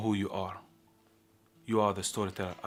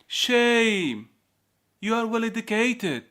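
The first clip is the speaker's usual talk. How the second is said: high, raised-voice reproach; scolding exclamation; 14 semitones higher, 6 dB louder, slower than usual. Acted. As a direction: call out the disappointment loudly. Guard scolds the storyteller.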